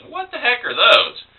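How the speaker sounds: high-pitched, baffled exclamation; mock bewilderment. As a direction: play a tree puzzled by a strange sight. Tree's imagined puzzled reaction.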